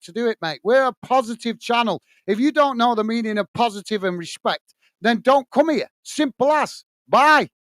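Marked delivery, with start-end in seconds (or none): 5.0-7.5 higher, sharp final dismissal; exasperated rant. Real, not acted. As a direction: brush the troll off for good. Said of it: "see me ranting".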